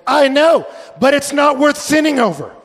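high, loud mock exasperation; acted-out emphatic protest; exasperated retort. Acts exasperated reply to excuse.

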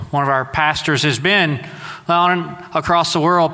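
ordinary talk, nothing marked

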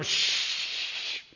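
drawn-out "shh"; crowd's hissed shush; long hushing shush. Acted. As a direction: shush someone sharply. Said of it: "the rest of the crowd look at him".

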